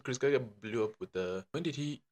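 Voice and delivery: ordinary delivery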